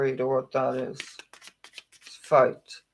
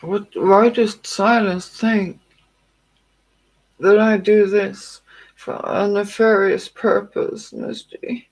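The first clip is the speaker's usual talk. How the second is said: hurt outburst; 4 semitones higher, 7 dB louder than usual. Real, not acted. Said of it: "That hurt me".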